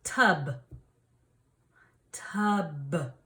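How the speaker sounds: tub with a clear final b; final consonant drill. Shown as a demonstration. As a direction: say tub and land the b. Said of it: "What sound is at the end".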